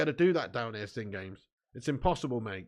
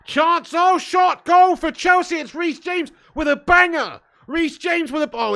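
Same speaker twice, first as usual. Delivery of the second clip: much higher and louder, yelling at a goal; excited goal shout; 13 semitones higher, 11 dB louder, slower than usual. Real, not acted. Streamer watching Chelsea score, shouting excitedly. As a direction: erupt like a fan seeing a banger.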